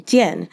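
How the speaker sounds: Jian, one syllable with a sharp falling tone; fourth tone Jian. Shown as a demonstration. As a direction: snap the pitch down on Jian. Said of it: "fourth tone".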